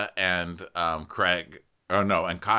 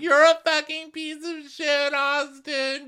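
very high, loud mock screech; hammed-up hysterical insult, stretched at the end; 4 dB louder, slower than usual. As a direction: imitate a wailing shriek. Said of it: "You just hear her screeching stuff".

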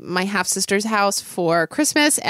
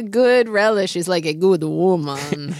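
put-on Russian accent; 3 semitones lower than usual. Host mock-asks co-host about relish remark, in Russian accent. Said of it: "That was a good accent".

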